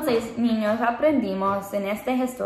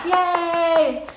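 high bright cheer; excited cheer; 7 semitones higher, 3 dB louder than usual. Acted. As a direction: cheer like for a kids' show. Cheer after announcing Spanish.

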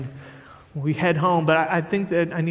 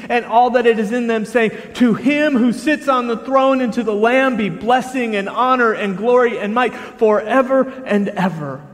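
high, loud preacher's reading, easing at the end; fervent scripture reading; 6 semitones higher, 7 dB louder than usual. Acted. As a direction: read it ringing out, then let it settle. Reads scripture aloud to congregation.

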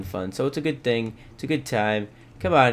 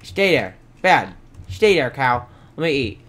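ordinary talk

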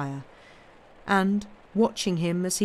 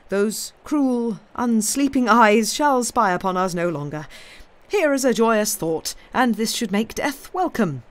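lilting, emphatic musing with higher swells; kindly gentleman, haunted; 3 semitones higher, 3 dB louder than usual. Acted. Gentleman confides to Tom about watching eyes, kindly but haunted. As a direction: benevolent old gentleman dwelling on unseen eyes.

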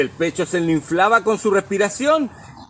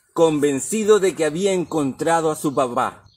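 ordinary delivery, nothing marked

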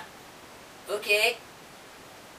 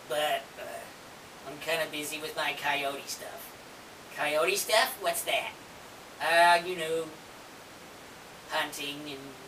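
low coyote voice; 4 semitones lower, 6 dB quieter than usual. Pretend. Puppet coyote Sid, low voice.

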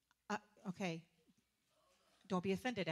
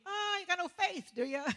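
higher-pitched teasing needling; sarcastic, pitched-up jab; sarcastic Jesus; 9 semitones higher, 4 dB louder than usual. Retold or imitated. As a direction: needle them with mock pity. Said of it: "it's so sarcastic to me".

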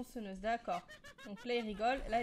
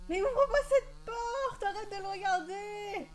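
panicked yell; 7 semitones higher, 4 dB louder than usual. Real, not acted. Panicked player yelling at game door.

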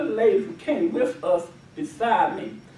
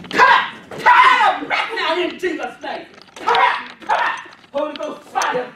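loud shouted deliverance prayer, commanding spirits out; preaching at full force; 3 semitones higher, 10 dB louder than usual. Acted. Preacher commanding spirits out of the women at the altar, shouting. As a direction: cast the demons out, full voice.

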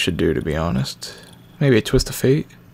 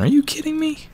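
incredulous. Real, not acted. Game assigns him Full Nelson.

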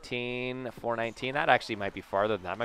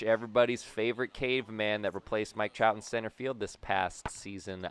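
usual speaking voice

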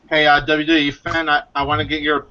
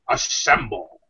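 low robot-leader impression; Optimus Prime; 6 semitones lower, slower than usual. Leader summoning the Autobots, low commanding voice. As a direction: command in a lowered, put-on voice. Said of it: "impression of Optimus Prime".

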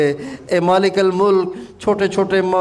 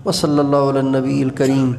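lower, subdued closing of a supplication; closing prayer; 5 semitones lower than usual. Real, not acted. A preacher asks that the prayers be accepted, closing low. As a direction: bring the plea down to a low amen.

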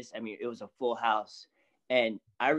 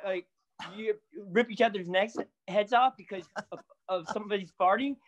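amused disbelief; 7 semitones higher than usual. Retold or imitated. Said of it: "I was like".

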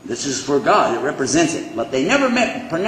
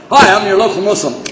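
louder, higher, quick greeting; mock door-knocker; 5 semitones higher, 6 dB louder, faster than usual. Preacher joking about knocking on doors, mock greeting. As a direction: pitch it like a doorstep caller.

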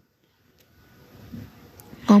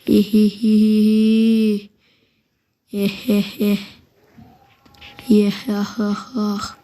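gloating cackle; much slower than usual. Real, not acted. Gloating after a kill.

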